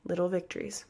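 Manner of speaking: soft, tender repeat; mother gently encouraging her daughter, tender and soft; gentle mom encouragement. Retold or imitated. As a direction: say it softly like a loving mom. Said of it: "she'd say".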